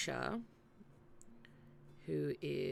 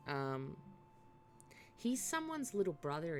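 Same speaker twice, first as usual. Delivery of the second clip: ordinary talk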